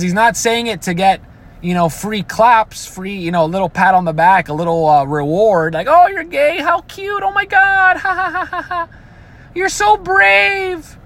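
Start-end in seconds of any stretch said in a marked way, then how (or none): 5.9-11.0 very high, gushing mock voice with a fake laugh; mocking fawning fan. Mocks a fawning audience.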